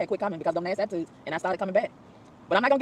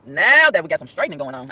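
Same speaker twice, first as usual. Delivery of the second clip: ordinary delivery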